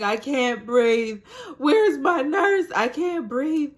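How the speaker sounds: high, loud, slow panic for air; panicked cry. Retold or imitated. To her sister, calling for the nurse in panic. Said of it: "I'm like".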